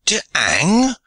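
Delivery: put-on To hang? with hang rising in a French accent; incredulous French accent. A narrator's reading. French pirate questioning his sentence, accented and rising. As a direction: ask To hang? in broken English, rising on hang. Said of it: "he cried in broken English".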